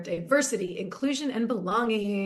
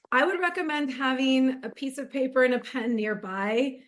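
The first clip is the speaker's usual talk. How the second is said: usual speaking voice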